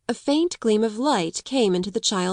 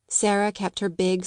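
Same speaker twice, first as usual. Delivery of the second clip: ordinary delivery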